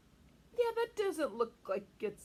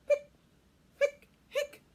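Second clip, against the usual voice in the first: high squeaky hiccups; loud high hiccups; hiccup sounds; 12 dB louder, slower than usual. Acted. Ghost figure with hiccups.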